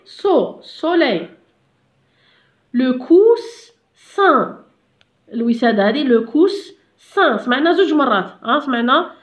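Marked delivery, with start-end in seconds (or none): 2.7-4.5 "coussin" very slow and higher, cut in two; drawn-out two-syllable "coussin"; split into syllables. Shown as a demonstration. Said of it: "نقسموها، قلنا هنا en deux". 6.0-7.4 "coussin" slowly, in two clear parts; slow teacher's sounding-out of "coussin"; split into syllables. Shown as a demonstration. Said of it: "نقسموها، قلنا هنا en deux".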